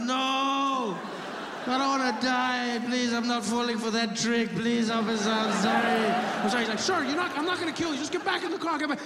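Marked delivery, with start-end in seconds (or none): 0.0-6.6 high, drawn-out mock wail; panicked pleading. Driver refuses officer's order, panicked pleading. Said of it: "I completely panicked". 6.8-9.0 very high, frantic reassurance; freaked-out policeman. Retold or imitated. Officer promises not to kill driver, freaked out. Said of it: "just as freaked out as I was".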